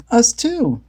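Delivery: high Velma voice; quick, matter-of-fact Velma. A narrator's reading. Velma answering Shaggy, high character voice. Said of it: "said Velma".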